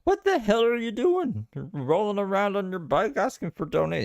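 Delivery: Jordan Peterson. Acted. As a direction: do a badly faked, high, exasperated impression. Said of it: "do a Jordan Peterson voice".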